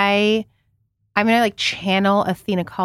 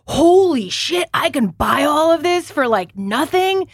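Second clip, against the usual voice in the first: much higher and louder, slow amazed gush; excited; 8 semitones higher, 3 dB louder, slower than usual. Retold or imitated. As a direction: relive the thrill of cheap clothes. Retelling first sample sale thrill.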